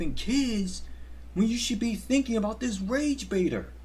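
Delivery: high, whiny sarcastic scolding; mock outrage. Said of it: "Clearly you guys knew I was fucking around".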